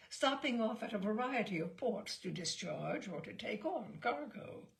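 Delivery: ordinary delivery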